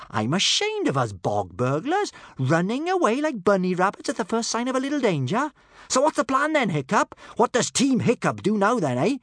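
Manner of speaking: high, loud, swaggering challenge; feisty girl. A narrator's reading. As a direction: goad your friends into action. Swaggering girl challenges her friends.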